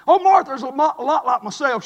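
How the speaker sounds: ordinary delivery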